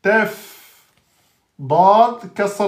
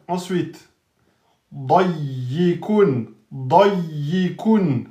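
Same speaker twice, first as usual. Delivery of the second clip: normal talking voice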